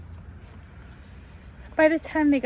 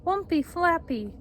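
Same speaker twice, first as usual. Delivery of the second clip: put-on upset baby babble; 6 semitones higher than usual. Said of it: "Now she's getting very upset".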